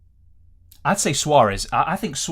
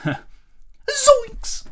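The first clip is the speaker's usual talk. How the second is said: much higher cartoon yelp of surprise; goofy cartoon sidekick startled; cartoon slacker voice. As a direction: squeak it out like a scared cartoon teen. Cartoon exclamation impression.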